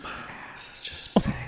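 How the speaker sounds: mock seductive whisper. Mock sexy Craigslist ad.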